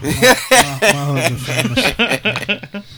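laughing through a proud brag. Real, not acted. Guest laughing as he boasts about his hugs, laughing. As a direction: chuckle out the boast.

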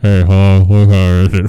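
very loud, low, laughing boss voice; deep loud voice. Acted. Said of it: "I hope his voice gets deeper".

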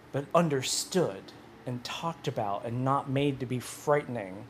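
normal talking voice

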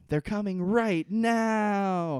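loud, high, drawn-out holler; imitated scream. Carl warning the camp about the Others, imitated scream. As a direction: belt out a slow, urgent warning shout. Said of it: "he really does scream it like that".

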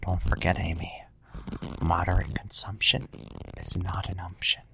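soft breathy ASMR whisper. Pretend. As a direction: hush it like an ASMR video. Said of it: "like, an A S M R".

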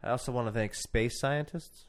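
ordinary talk

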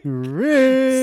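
high call of a name; mock call. Echoing the call.

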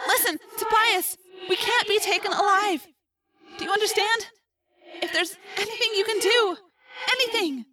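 put-on high, distant girl, urgently pleading; trapped Rachel. A narrator's reading. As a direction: beg a friend in a high, faraway voice. Plays trapped Rachel in narration.

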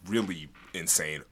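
ordinary talk, nothing marked

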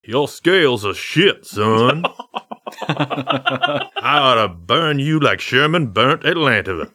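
hammed-up Southern blowhard dad; mock Foghorn Leghorn dad; Foghorn Leghorn accent. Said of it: "he has that foghorn leghorn accent".

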